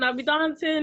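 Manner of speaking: ordinary delivery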